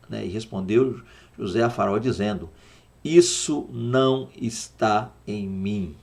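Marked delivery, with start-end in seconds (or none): 3.0-6.0 slow reading. Shown as a demonstration. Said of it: "Olha aí".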